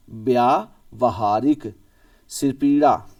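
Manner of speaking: व्यावहारिक drawn out syllable by syllable, vya-va-ha-rik; slow, careful spelling-out. Shown as a demonstration. Teacher drawing out व्यावहारिक for students to see. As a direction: say व्यावहारिक very slowly with a slight rise. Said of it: "ये देखो".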